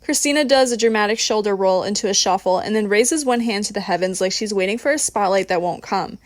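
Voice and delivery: plain reading voice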